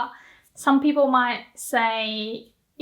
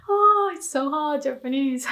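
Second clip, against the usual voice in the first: playful exasperation; 2 semitones higher than usual. Real, not acted. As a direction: lament it lightly, with a laugh to follow. Playful complaint about learning Japanese.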